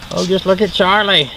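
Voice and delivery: ordinary talk, nothing marked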